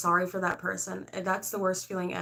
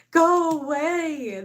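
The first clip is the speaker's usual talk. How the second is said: higher, louder, very slow "Go away"; hammed-up yell; 9 semitones higher, 8 dB louder, much slower than usual. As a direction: shout them off, stretching each word. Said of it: "That's how I feel".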